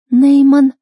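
high, loud cry for someone; girl's voice calling out in torment, high and loud; anguished girl's call. A narrator's reading. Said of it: "такой измученный и безжизненный". (высокий громкий измученный девичий зов)

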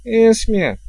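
high cry of her name; sharp high exclamation; exclaimed appeal. A narrator's reading. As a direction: call out in protest, pitched well up. King cries out her name in appeal.

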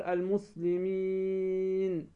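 المسلمين with the final ī held long and level; long ya madd. Shown as a demonstration. Teacher showing students where ya comes from, long ya madd on المسلمين. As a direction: draw out the ي of المسلمين before closing on n. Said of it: "الياء تقول".

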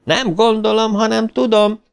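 high, excited shriek. A narrator's reading. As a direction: squeal it out in glee.

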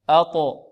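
أقو أب أج أد with a bouncing stop; qalqala drill. Shown as a demonstration. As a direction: repeat the echoing stops for the class. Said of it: "نطبق الآن". (تطبيق القلقلة)

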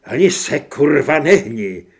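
very loud, explosive order to freeze; furious shouted threat. A narrator's reading. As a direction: yell the warning, hammering the swear word. Repeats gunpoint threat, now swearing. (vzteklý křik se zbraní v ruce)

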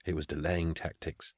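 very soft, understated reply; quiet. A narrator's reading. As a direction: drop right down to near murmur. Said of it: "said Frauke quietly".

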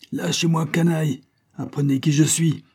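high-pitched indignant outburst; enraged protest. A narrator's reading. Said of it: "en se débattant avec rage". (protestation rageuse)

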